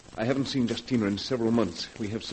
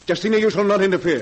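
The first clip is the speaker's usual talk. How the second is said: higher, louder and fast, cutting her off; angry outburst; 9 semitones higher, 8 dB louder, faster than usual. Acted. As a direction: slam the door on her plan. Brother forbids sister's meddling.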